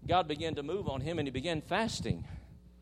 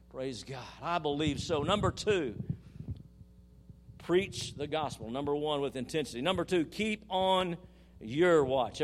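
normal talking voice

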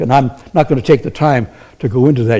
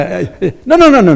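normal talking voice

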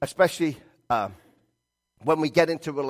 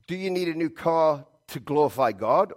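normal talking voice

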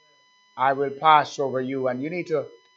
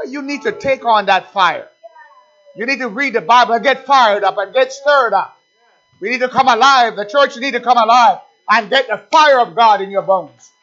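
loud, raised, urgent exhortation; fired-up preaching; 6 semitones higher, 7 dB louder than usual. Real, not acted. Preacher urging the church to come alive, full-force exhortation. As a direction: preach it hard, building in force.

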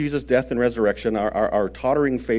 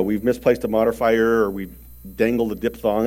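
ordinary talk, nothing marked

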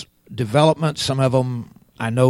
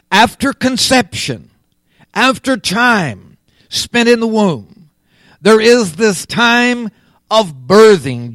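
raised preaching voice in measured phrases; emphatic preaching; 6 semitones higher, 6 dB louder than usual. Real, not acted. Preacher expounding birth of the Spirit to the congregation, emphatic preaching. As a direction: drive each phrase home with pauses.

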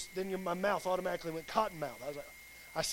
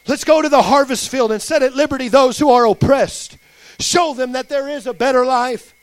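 loud, impassioned sermon delivery; shouted, emphatic exhortation; full-force preaching; 5 semitones higher, 16 dB louder than usual. Real, not acted. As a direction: preach it with mounting fire, driving every phrase home. Closing exhortation.

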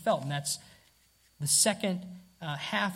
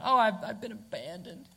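higher, theatrical sigh of being abandoned; sensationalized lament; 4 semitones higher than usual. Retold or imitated. Preacher mocks our sensationalized complaint, mildly theatrical. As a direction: overplay the lament a little. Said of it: "we sensationalize the account".

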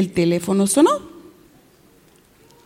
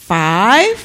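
FIVE stretched with a rising tune; deliberate counting; 3 semitones lower than usual. Acted. Cashier recounts the money, deliberate rising count. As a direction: count out FIVE, lifting the end. Counts bills aloud for children.

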